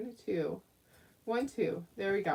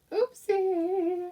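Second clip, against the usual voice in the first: playful oopsie; 9 semitones higher than usual. Real, not acted. Small slip while crafting.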